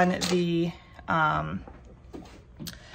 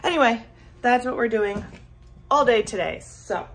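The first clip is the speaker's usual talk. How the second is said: ordinary talk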